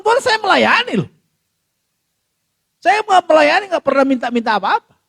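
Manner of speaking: high, loud pleading outcry; pleading shout heavenward; anguished cry to God. Retold or imitated. Pastor crying out to God.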